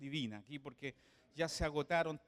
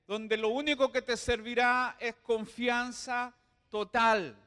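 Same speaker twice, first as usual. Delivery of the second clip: loud, high sermon climax; preaching at full force; 5 semitones higher, 7 dB louder than usual. Acted.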